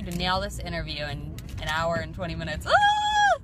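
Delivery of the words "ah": very high, loud squeal; excited squeal. Real, not acted. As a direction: let out a real high squeal. Excited squeal before the interview.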